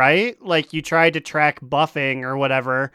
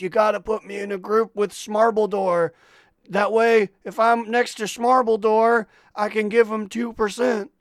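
higher-pitched quote of a pleading teammate; imitated scheming player; 6 semitones higher than usual. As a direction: lightly put on a raised, lobbying voice. Mimics player scheming for group spot.

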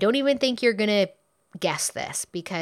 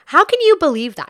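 indignant outburst; 7 semitones higher, 8 dB louder, faster than usual. Retold or imitated. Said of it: "That's what she says".

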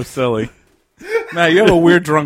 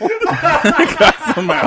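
laughing echo; 13 semitones higher, much slower than usual. Retold or imitated. Mocking echo of place name.

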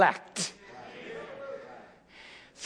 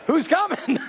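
laughing; 3 semitones higher than usual. Real, not acted. Preacher laughing mid-exhortation.

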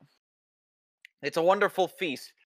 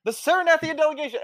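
pompous fussy courtier; 13 semitones higher, 3 dB louder, faster than usual. Acted. Herald announces again.

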